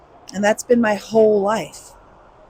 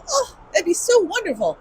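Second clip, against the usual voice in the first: wistful high aside; 9 semitones higher than usual. Real, not acted.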